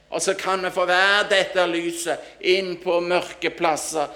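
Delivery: loud, driving sermon delivery; impassioned preaching. Acted. Preacher calling believers to be light in darkness, impassioned preaching. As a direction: preach it out with force.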